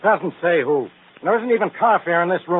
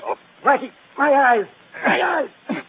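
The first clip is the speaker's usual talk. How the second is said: pained cry; 8 semitones higher, 4 dB louder, slower than usual. Acted. Cigarette trick blinds the inspector.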